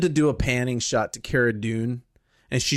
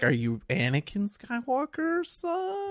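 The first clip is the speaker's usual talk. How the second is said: timid question; 10 semitones higher, 7 dB quieter, slower than usual. Acted.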